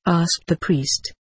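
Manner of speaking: plain reading voice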